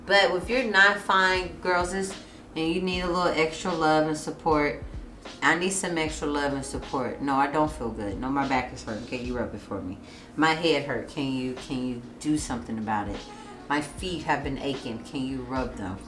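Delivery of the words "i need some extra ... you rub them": low, soft request for comfort; understated, low-voiced plea; soft pleading. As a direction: quietly ask your partner for some babying. Acting out asking partner for comfort.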